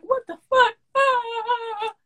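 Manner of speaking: high broken sob on the phone; crying. Retold or imitated. To her boyfriend on the phone, sobbing high. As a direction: wail it like you're breaking down. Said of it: "I was on the phone crying to him".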